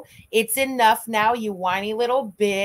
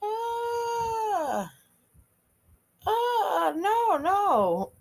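stunned; 10 semitones higher, 4 dB quieter, much slower than usual. Real, not acted. Stunned by clip statement.

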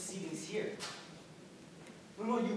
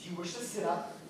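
ordinary talk

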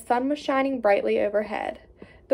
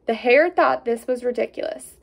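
usual speaking voice

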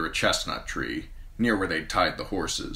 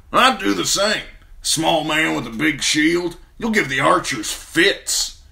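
higher, louder rough sellsword; 10 semitones higher, 7 dB louder than usual. A narrator's reading. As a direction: play the cocky sellsword.

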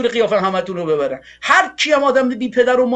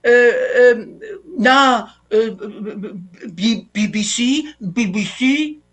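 mock stammering; slower than usual. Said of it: "که اومد نشست گفتش که".

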